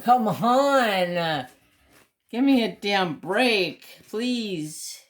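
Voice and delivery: retold groan of exasperation; exasperated plea. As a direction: whine it out, fed up with the phone. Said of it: "I'm like".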